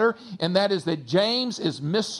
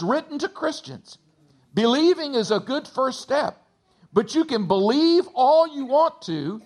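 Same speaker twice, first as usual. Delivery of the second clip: higher, rising preacher's drive; preaching; 4 semitones higher than usual. Acted. Pastor driving home that doing matters, preaching with rising force. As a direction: push the point home to the church.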